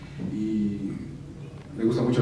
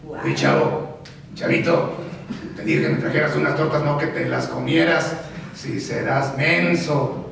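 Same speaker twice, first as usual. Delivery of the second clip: Don Ramón voice; 3 semitones higher, slower than usual. Retold or imitated. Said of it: "Sí, Don Ramón".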